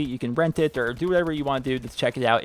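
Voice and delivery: ordinary talk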